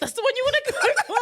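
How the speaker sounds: high, loud, fast laughing question; laughing disbelief. Real, not acted. Friend amazed at the lyric choice, laughing loudly. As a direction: shriek it through laughter.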